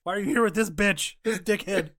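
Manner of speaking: loud, high mock trash talk; Don the Dragon Wilson. Kickboxer insulting his rival's company, comic shouted taunt. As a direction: hurl the insult in a hammed-up yell. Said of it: "Don the Dragon Wilson was up here like".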